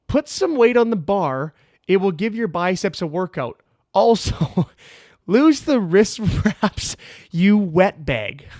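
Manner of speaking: loud, high mock scolding, drawn out. Gym girl scolding his curls in a comment, mock scolding. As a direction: read her snarky comment in a slow, loud mocking voice. Reads out her snarky comment, laughing.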